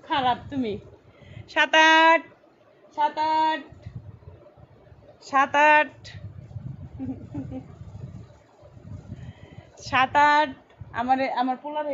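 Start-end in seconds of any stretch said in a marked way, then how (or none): 1.5-10.5 sustained, level-pitched hawking call. Real, not acted. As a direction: call out each one, stretching the last word. Calling out the size to viewers.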